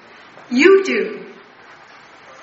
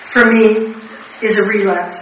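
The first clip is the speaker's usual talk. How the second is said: much louder, preaching it to the room; forceful; 3 semitones lower, 8 dB louder than usual. Real, not acted. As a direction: drive the point home to the whole meeting. Addict hammering home her message.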